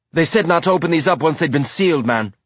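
fast, words running together; rapid run-together speech. A narrator's reading. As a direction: rush it all in one breath. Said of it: "speaking so fast he ran all the words together".

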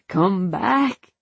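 restrained plea, rising on BACK; implore, lifting the last word; begging. A narrator's reading. Man at his brother's lifeless body, begging. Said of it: "he begged".

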